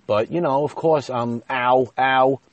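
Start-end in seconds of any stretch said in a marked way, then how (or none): 1.5-2.4 louder, higher re-enacted "ow"; acted pained yelp. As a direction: yelp as if your piercing is being twisted. Re-enacts pain at eyebrow being twisted.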